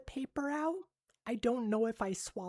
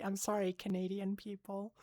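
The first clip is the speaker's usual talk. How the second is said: usual speaking voice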